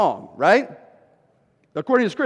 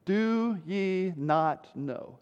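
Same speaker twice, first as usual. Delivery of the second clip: very slow, soft, word by word; deliberate verse quote; 8 dB quieter, much slower than usual. Shown as a demonstration. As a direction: spell it out slowly and quietly. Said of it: "I want you to notice those first four words".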